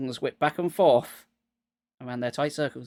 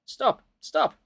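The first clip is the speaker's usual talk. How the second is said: high, loud command; boy shouting stop; shouted up; 6 semitones higher, 4 dB louder than usual. A narrator's reading. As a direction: yell up at the bugs to stop. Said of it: "shouted up at them".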